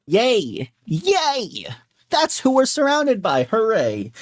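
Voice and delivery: high, hammed-up fake cheering; sarcastic cheer. Sarcastic cheer at bad news.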